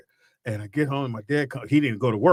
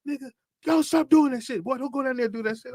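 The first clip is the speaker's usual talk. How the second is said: dad yelling; 11 semitones higher than usual. Retold or imitated. Dad scolding the boys for skating downhill, mock yell. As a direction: imitate the dad shouting a warning. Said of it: "And my dad like".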